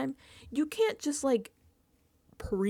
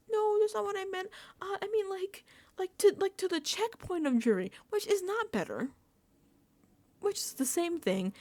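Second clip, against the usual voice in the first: higher, fumbling mock nervous voice; flustered backtrack; 10 semitones higher than usual. Morgan backtracking to Rachel about jury, high and fumbling. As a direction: stumble over it, trying to undo it. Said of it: "trying to backtrack so hard".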